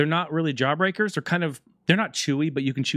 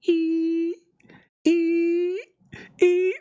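nose whistle squeak; much slower than usual. Retold or imitated. Imitating blocked nose whistle.

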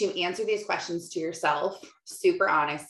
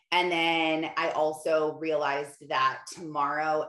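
ordinary talk

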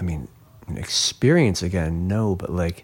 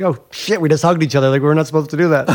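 higher, louder alarmed outburst ending in a laugh; suddenly remembering no hugging, alarmed then laughing; startled realization; 7 semitones higher, 6 dB louder, much faster than usual. Retold or imitated. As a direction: catch yourself mid-hug, half laughing. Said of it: "I'm like".

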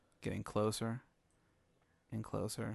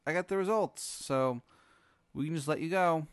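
loud, high mock New York alien; alien Tony; 8 semitones higher, 6 dB louder than usual. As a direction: belt it like a cabbie, then settle. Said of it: "he's like".

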